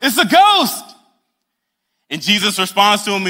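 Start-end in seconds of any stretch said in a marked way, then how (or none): 0.0-0.9 high, loud frightened cry; panicked yell. Retold or imitated. Disciples terrified by the ghost, high panicked yelling. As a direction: yell it in terror. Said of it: "they scream".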